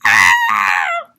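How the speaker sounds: loud low startled "Ah!"; children's scream. Acted. Children scream at the fake Santa, loud startled shout. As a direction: shout it like a crowd of scared kids. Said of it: "all the children screamed".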